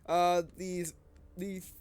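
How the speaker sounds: winded, halting with a heavy sigh; out of breath. Real, not acted. Gamer apologizing to viewers mid-video, out of breath. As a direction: stop to catch your breath mid-sentence. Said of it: "I'm a little out of breath".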